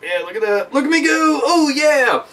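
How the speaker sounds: rising proud gloat; gloating cheer. Pretend. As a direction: show off your aim. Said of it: "you can do the old".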